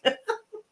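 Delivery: short high burst of laughter; quick high giggle. Real, not acted. Reader giggling after repeating the viewer's line. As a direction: let a quick giggle escape. Amused at the question.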